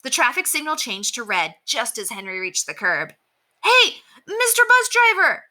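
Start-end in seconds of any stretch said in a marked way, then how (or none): 3.6-5.5 much higher, louder, stretched call after the bus; boy calling out frantically after a bus; frantic call. A narrator's reading. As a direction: shout frantically to stop the departing bus. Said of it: "Henry called out frantically".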